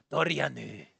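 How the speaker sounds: low old man, mock-Japanese vowels; measured, put-on Japanese accent; fake Japanese accent. Old man approves of new name, fake Japanese accent. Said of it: "end your fake Japanese accent on".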